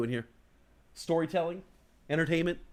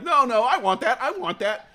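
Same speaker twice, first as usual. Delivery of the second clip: greedy inner voice; 10 semitones higher, 8 dB louder than usual. Retold or imitated. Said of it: "your mind's like".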